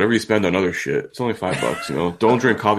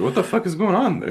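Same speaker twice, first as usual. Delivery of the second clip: surprised; 12 semitones higher than usual. Retold or imitated. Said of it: "I was like".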